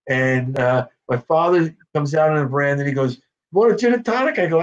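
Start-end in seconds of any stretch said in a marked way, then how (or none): 3.5-4.4 father's voice. Retold or imitated. Father offering drink, voiced.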